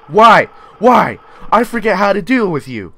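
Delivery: high, very loud desperate shout; panicked yell. Real, not acted. Player forgetting how to deal with a creature, panicked yelling. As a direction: wail at it in sudden panic.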